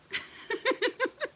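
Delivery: very high squeal of laughter; real delighted laugh. Real, not acted. Laughs at kid's joke.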